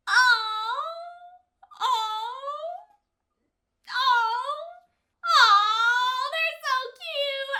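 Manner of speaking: a run of loud, drawn-out ohs; gushing coo. Real, not acted. Reactor gushing over the cute couple on screen.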